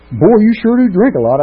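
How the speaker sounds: high, loud mock admiration from a drinking buddy; admiring barfly. People at the bar praising his drinking, loud mock admiration. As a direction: ham up the impressed drinker. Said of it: "People would come up to me and say".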